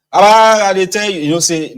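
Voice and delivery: high, loud excited exclaim; loud high Pidgin outburst of amazement; excited mock cry. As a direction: act out a worked-up believer. Acting excited believer.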